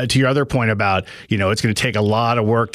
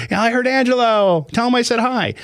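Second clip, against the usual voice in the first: high, bright excited wife; 13 semitones higher than usual. Retold or imitated. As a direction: squeal it like a thrilled wife. Host quotes wife's excited text.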